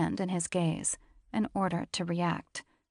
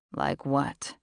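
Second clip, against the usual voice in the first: lower, husky-free steady man's voice; low male voice; 4 semitones lower than usual. A narrator's reading. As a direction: voice him low and cool.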